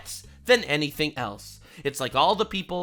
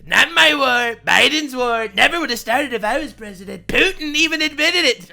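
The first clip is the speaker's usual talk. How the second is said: louder, higher bragging claim; bragging Trump; 6 semitones higher, 7 dB louder than usual. Retold or imitated. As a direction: boast it like a rally speech. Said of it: "he's like".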